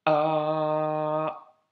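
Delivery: A held long after a throat catch; block, then stretch the A out; long A opened by a glottal stop. Shown as a demonstration. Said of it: "un petit blocage avec un A long".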